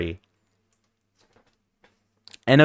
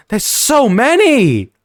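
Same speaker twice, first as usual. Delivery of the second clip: exasperated; 9 semitones higher, 4 dB louder, slower than usual. Real, not acted. Overwhelmed by flavor list.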